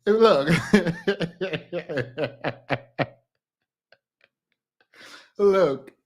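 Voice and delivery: trying to talk through laughter; can't stop cracking up; laughing fit. Real, not acted. Cracking up while trying to make her point, laughing.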